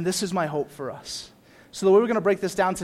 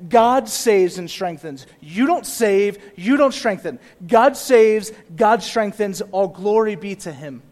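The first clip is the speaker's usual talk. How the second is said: louder, slow, punching God; forceful preaching; 5 dB louder, slower than usual. Acted. Pastor proclaiming God saves to congregation, forceful. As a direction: drive the point home to the church. Preaching his main point.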